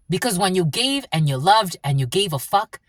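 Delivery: normal talking voice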